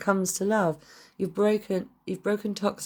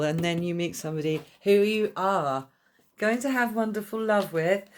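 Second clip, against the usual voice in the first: normal talking voice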